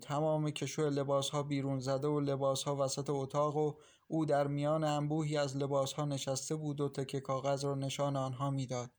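usual speaking voice

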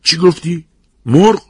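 high-pitched, jumpy disbelief; pitched right up, taken aback; startled question. A narrator's reading. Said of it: "یک مرتبه از جا پرید و پرسید".

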